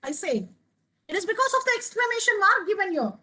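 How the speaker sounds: usual speaking voice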